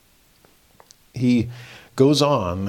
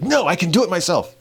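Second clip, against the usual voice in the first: put-on stubborn little boy; 7 semitones higher than usual. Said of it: "And he's like".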